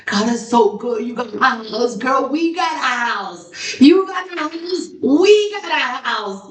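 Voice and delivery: high, rising gushing celebration for a friend's house; mock tearful joy. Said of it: "I start crying because I'm like".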